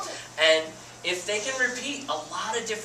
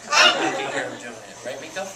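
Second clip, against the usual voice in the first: ordinary talk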